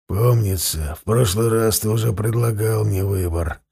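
low, rasping croak; hoarse wheeze. Acted. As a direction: force the words out hoarsely through a dry throat. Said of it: "прохрипел он".

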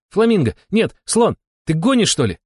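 high-pitched heated back-and-forth; excited guessing. A narrator's reading. Players throwing guesses at each other, excited. Rapid guessing game quarrel.

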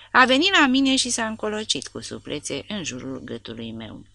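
ordinary delivery

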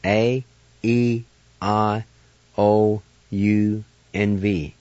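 each vowel said slowly and clearly; careful teacher vowel drill; slow vowel list. Shown as a demonstration. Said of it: "We have six vowels in our language".

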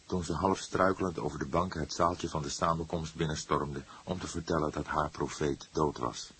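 ordinary delivery

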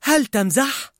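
high, incredulous complaint; grumbling. A narrator's reading. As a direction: snap back in disbelief. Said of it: "ردت الأم بتذمر".

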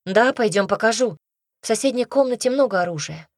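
lightly voiced girl, speeding up towards the end; girl moving away. A narrator's reading. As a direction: answer as a girl hurrying off. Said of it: "Голос Лизы стал удаляться".

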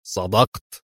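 understated stern narration, drawn low; a touch of severity. A narrator's reading. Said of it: "أتاه صوت صارم من فوقه يقول".

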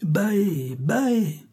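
high, drawn-out calls; crowd's cry. A narrator's reading. Crowd approves the death sentence, crying out. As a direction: imitate many voices calling assent. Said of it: "crièrent toutes les voix".

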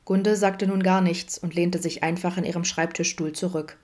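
ordinary delivery

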